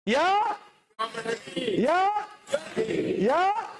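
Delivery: high rising yeah calls; high call for a cheer; hyping the crowd. Real, not acted. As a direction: pump the room up.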